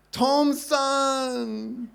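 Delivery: high, bright cry of a name; joyful recognition. Retold or imitated. Old woman recognizing a long-lost friend, a high joyful cry. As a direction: call his name out in sudden delight.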